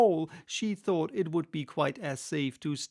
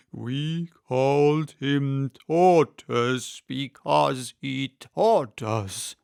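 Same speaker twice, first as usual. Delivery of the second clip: slow, louder, indignant turtle; angrily; 8 dB louder, much slower than usual. Acted. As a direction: huff at the silly question. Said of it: "said the Mock Turtle angrily".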